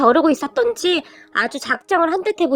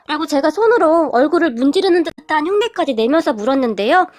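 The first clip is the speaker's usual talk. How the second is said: ordinary delivery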